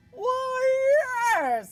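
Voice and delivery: loud, proud why yes; a show-off dragon; boastful dragon. Acted. Dragon bragging to the princess, loud.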